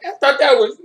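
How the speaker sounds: high, loud squeaky protest; mock caught offender. Gang member learns his Facebook was public, squeaky mock protest. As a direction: play a dumb guy caught out in court. Voicing a guy caught in court.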